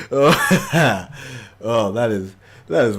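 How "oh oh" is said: jovial laugh. Acted. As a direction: laugh heartily like a jolly host. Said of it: "he starts laughing, a sort of jovial, jolly type".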